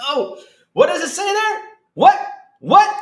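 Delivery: ordinary talk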